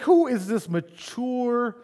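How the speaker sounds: high, loud, drawn-out disbelief; mock amazement. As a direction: stretch out the astonished question. Pastor amazed by child's mature reply.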